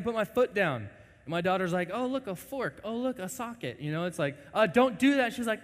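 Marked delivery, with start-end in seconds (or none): 1.9-3.8 put-on high, curious little girl; a small child delighted by a socket; childlike wonder. Voicing curious daughter. 4.5-5.3 high, loud warning; parent warning. Retold or imitated.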